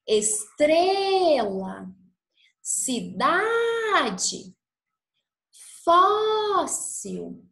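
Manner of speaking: "estrela, cidade, fóssil" with TRE, DA, FÓS sung out high and long; stress on penultimate syllable. Shown as a demonstration. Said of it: "Então, a penúltima". ("estrela, cidade, fóssil" com penúltima sílaba aguda e esticada)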